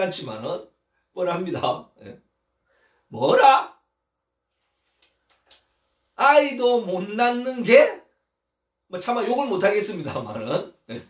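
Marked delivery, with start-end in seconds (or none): 3.1-8.0 raised, loud, slowly drawn-out outrage; affronted mistress, each word stretched; indignant comeback. Acted. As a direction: throw it back in acted disbelief, high and loud. Acted indignant comeback of Sarah.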